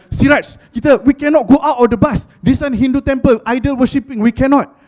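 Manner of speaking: loud, high alarmed refusal; mock zealous friends. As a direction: ham up panicked religious kids. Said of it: "They're like".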